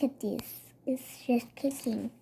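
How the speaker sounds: whispered aside; hushed aside to the listener. Real, not acted. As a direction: drop to a whisper. Aside while reading.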